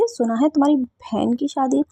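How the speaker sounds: slowed, careful clarifying tone; slow correction. Acted. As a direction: spell it out so she can't mishear. Corrects herself, spelling it out.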